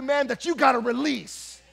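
loud, high, driving sermon cry; preaching at full force. Acted. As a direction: preach it hard to the congregation.

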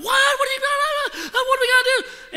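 high, loud panicky cry with a nervous laugh; reliving the moment he freaked out; panicked. Retold or imitated. To his friend with the severed finger, high panicked cry.

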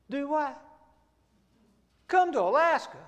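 can't believe what he just heard; disbelieving surprise. Retold or imitated. Young man stunned by the invitation to Alaska, surprised. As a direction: pitch jumps up in astonishment.

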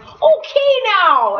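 drawn-out Minnesota transition; Minnesota accent. Shown as a demonstration. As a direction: stretch it out Minnesota style. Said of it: "a bit of a Minnesota accent".

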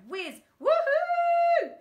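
very high loud whoop; screamed. A narrator's reading. Boy screaming with joy as the car flies, loud whoop. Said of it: "screamed the boy".

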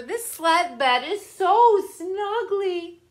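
high, slow contented sigh; cozy delight. A narrator's reading. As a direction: savor the comfort. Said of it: "thought Parker".

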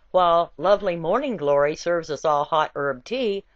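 plain reading voice

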